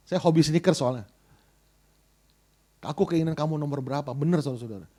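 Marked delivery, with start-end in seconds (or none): 2.8-4.1 low, quiet questioning; voice of God. Retold or imitated. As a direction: ask softly and low, as God reproaching. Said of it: "Seolah berkata gini". (suara Tuhan bertanya, rendah dan pelan)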